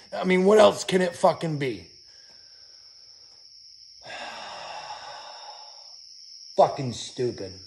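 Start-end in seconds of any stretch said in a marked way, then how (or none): 4.1-4.9 weary sigh. Real, not acted. Weary sigh after ranting.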